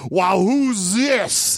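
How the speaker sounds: high, slow amazed drawl; mock surprised father. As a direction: size up the odd stranger, amused. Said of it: "the father's like".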